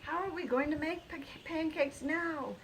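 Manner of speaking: high, stammering worry; panicky. A narrator's reading. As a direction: fret and stumble over it. Carson panicking over broken machine.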